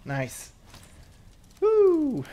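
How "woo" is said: high, loud, drawn-out woo; excited woo. Real, not acted. Player seeing the new armor, excited whoop.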